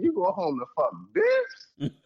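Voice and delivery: high, teasing incredulity; mocking disbelief. Friend mocking the sailor about his wife, high teasing. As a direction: rib him like he's lost his mind.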